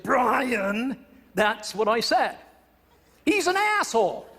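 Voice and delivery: blunt extrovertese. Retold or imitated. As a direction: imitate a fed-up, plain-speaking extrovert. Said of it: "He spoke in classic extrovertese".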